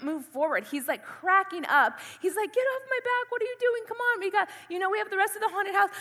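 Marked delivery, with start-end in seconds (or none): 2.5-5.9 high-pitched imitation of exasperated boyfriend; protesting boyfriend. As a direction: urge her on, raised pitch, mock exasperation.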